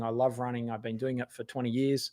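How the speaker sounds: ordinary delivery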